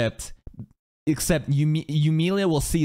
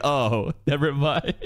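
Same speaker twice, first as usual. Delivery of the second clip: mock letdown; 6 semitones lower, slower than usual. Acted giving up on catching.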